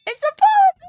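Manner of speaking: very high, loud squeal; excited shout. Real, not acted. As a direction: shriek it with delight.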